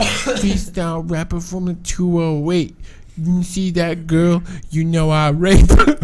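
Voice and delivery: slow, high-pitched wannabe rapper; coworker impression. Continuing the work guy's boast, slow high voice. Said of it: "He seriously talks like that".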